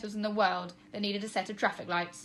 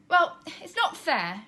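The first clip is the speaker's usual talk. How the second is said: grumbling; 7 semitones higher, 6 dB louder, slower than usual. A narrator's reading. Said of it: "she grumbled".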